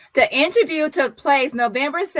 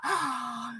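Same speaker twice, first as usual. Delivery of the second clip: soft gasp; 9 dB quieter than usual. Real, not acted. Shocked reaction while reading.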